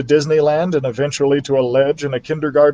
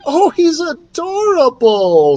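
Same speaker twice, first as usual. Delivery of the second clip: high, slow delighted squeal; 13 semitones higher, 4 dB louder, slower than usual. Acted. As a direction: gush over the cute mouse. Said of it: "squealed Mary Lou".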